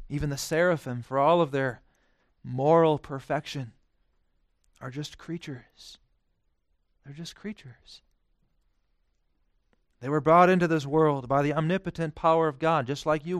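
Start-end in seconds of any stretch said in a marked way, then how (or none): 4.8-8.0 soft, slow, dropped-down voice; quiet reverent aside; hushed. Acted. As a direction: let it fall to a hush. Hushed contrast after climax.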